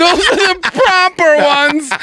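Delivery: high, laughing put-on British voice; laughing mock British accent. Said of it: "British accent".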